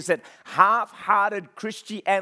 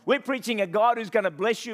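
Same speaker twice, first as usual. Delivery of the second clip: ordinary talk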